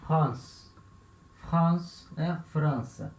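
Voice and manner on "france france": France said with a nasal an, no final e; French France. Shown as a demonstration. Teacher names the country in French. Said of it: "O nome do país".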